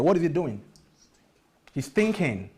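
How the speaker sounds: ordinary talk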